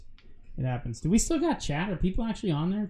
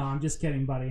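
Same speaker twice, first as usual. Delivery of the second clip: ordinary delivery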